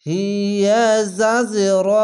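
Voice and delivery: slow chanted recitation with a bouncing জ in জাজ; Quranic recitation. Shown as a demonstration. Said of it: "দেখতে পাচ্ছেন কিভাবে পড়তে হচ্ছে".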